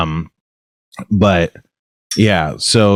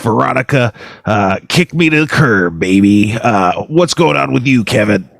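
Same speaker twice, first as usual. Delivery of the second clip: the Spleen. Acted. Morning DJ bantering with co-host, hammy Wolfman Jack-style voice. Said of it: "really good Wolfman Jack impression".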